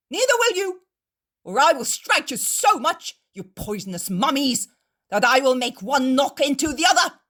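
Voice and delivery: loud, high, menacing orders; angry threat. Acted. Innkeeper forbids the Pharisees to follow, angrily. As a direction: threaten to knock their heads together.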